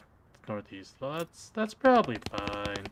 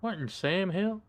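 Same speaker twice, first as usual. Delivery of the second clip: folksy cowboy; 7 semitones higher than usual. Acted.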